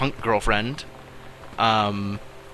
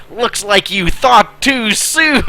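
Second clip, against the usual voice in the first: slow, higher, louder gloating line; hammed-up first mate; 10 semitones higher, 7 dB louder, slower than usual. First mate gloating at the captain, slow hammy shout. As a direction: drag it out like a smug sailor. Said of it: "The first mate said".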